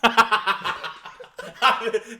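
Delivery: real high laugh bursting out; genuine laugh. Real, not acted. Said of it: "give us a genuine laugh".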